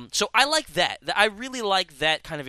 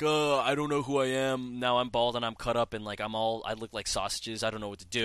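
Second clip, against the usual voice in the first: lower, fading mock-groggy voice; dazed game hero; 4 semitones lower than usual. Game hero waking up bald and cut up, mock dazed mumble. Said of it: "when you first wake up and you're like".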